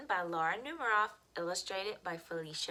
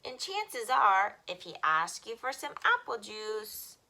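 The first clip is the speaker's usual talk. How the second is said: put-on bright, lively read-aloud for children; expressive picture-book reading; storytime reading; 9 dB louder than usual. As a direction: read it like storytime for little kids.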